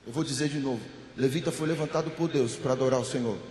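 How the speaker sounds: talking inside a bathroom; echoing bathroom. Real, not acted. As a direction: bathroom echo on the voice. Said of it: "parece que eu tô falando dentro do banheiro".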